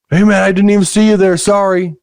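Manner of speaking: high-pitched imitation of an apologetic stranger; apologetic driver. Driver apologizing after nearly hitting him, high-pitched apology. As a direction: play the harmless dude, pitched well up.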